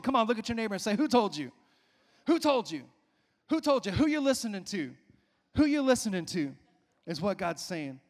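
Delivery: shouted, high exhortation to the congregation; full-force preaching. Acted. Pastor urging the congregation to ask each other, shouting.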